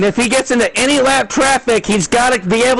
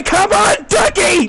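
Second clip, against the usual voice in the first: high excited rooting call; cheering; 5 semitones higher, slower than usual. Real, not acted. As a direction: yell him home to the win. Rooting for the leader.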